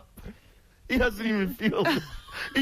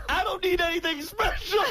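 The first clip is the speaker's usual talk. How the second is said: indignant outburst; 11 semitones higher than usual. Retold or imitated. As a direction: blurt it out like you've been insulted. Beetle snaps at airport security.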